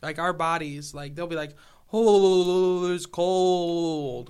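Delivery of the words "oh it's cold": loud, drawn-out complaint about cold; mock freezing. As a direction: wail it out like a chilled tourist. Mimicking warm-state visitors shivering in cold.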